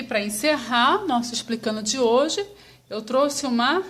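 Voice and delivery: normal talking voice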